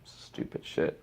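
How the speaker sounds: soft unvoiced mutter of disgust. Real, not acted. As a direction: spit it out quietly. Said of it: "repeated mutter".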